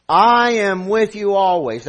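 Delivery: "I" stretched out and loud; stress on I. Shown as a demonstration. Preacher reads Jesus' promise, emphatic long "I". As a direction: lean hard on the word "I". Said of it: "I" is emphatic".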